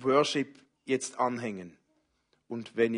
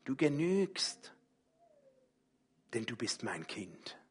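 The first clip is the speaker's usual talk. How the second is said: much softer, intimate promise; tender reassurance; 3 semitones higher, 3 dB quieter than usual. Pretend. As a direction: let it sink in quietly. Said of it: "Ich glaube, dass Gott sagt".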